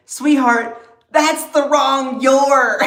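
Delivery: high, loud, drawn-out exasperation; loud mocking correction. Real, not acted. Streamer correcting a commenter's spelling, loud and mocking. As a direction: belt out the correction, stretching the last words.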